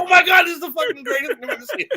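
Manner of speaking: high, fast, laughing shout of delight; laughing excited shout. Retold or imitated. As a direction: blurt it out cracking up with joy. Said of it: "I was like".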